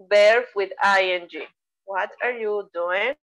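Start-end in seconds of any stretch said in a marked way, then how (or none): 1.8-3.2 slow, careful "What are you doing?" with each word separate; teacher's unhurried model question; slow model sentence. Shown as a demonstration. Teacher models the target sentence slowly.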